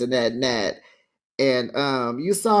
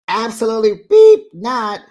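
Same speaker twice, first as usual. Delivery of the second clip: high loud emphatic quote; loud mock quote; 7 semitones higher, 10 dB louder than usual. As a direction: bark it out emphatically.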